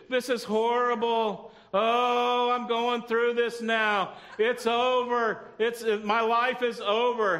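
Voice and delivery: drawn-out, hammed-up lament; mock despair. Preacher voicing how we usually face trouble, mock despair. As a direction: wail theatrically, stretching the words slowly.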